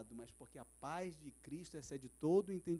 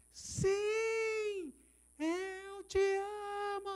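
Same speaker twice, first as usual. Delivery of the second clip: high, slow, crying cry of love; tearful shout; 14 semitones higher, much slower than usual. Retold or imitated. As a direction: break down as you shout it. Man finally shouts in tears.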